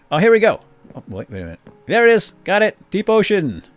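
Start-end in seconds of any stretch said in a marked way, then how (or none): 0.1-0.6 loud, high burst of excitement; excited discovery. Real, not acted. Spots the biome being searched for. 1.9-3.6 loud, high, triumphant calls; excited discovery. Real, not acted. Finds the deep ocean at last.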